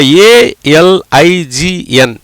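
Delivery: each letter spelt out slowly; slow spelling. Shown as a demonstration. Teacher spelling a word for students, slowly. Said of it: "spelling out slowly".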